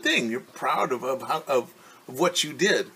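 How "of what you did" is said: plain reading voice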